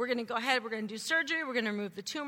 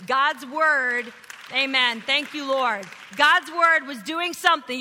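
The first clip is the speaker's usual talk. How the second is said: louder, higher proclamation; preaching at full force; 3 semitones higher, 10 dB louder, slower than usual. Real, not acted. Pastor shouting praise to the congregation. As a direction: ring it out like a sermon peak.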